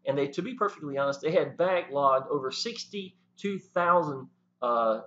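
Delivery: ordinary talk, nothing marked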